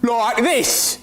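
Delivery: high, exaggerated Aussie twang; mock Australian accent. Mocking the newcomer's sound in a high Australian accent. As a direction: mimic an Australian, pitched well up. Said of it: "going to sound like that".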